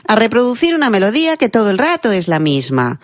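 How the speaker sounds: high, hammed-up lilt looping through "melodía" and "todo el rato"; exaggerated singsong. Shown as a demonstration. Coach exaggerating announcers' bad habit, high singsong on "melodía que todo el rato". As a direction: parody the announcer's unnatural repeated tune, "rato" swung high. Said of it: "porque nosotros no hablamos así".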